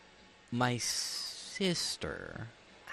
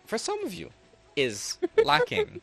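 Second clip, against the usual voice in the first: For some of you, is lacking in a squeaky high voice; much higher, drawn-out redo; 4 dB louder than usual. Shown as a demonstration. GM redoing the line at the player's urging, high squeaky voice. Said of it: "Call name, call name".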